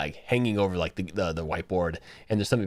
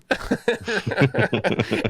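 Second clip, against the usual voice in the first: high laugh; 9 semitones higher, 3 dB louder than usual. Real, not acted. Laughing at the story.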